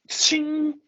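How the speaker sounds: high sung ching of a blade leaving its sheath; sword ching sound effect. Acted. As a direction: ring out like steel. Sword being drawn.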